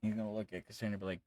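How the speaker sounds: ordinary talk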